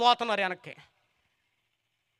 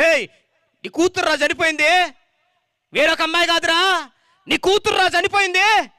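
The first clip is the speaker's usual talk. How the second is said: higher, louder indignant scolding; angry mourners; 11 semitones higher, 8 dB louder than usual. Acted. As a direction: berate the father. Mourners quarrel with Jairus. (కోపంగా అరిచే బంధువులు)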